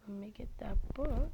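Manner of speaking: hushed whisper so grandma won't hear. Real, not acted. Boy sneaking past grandma, whispering.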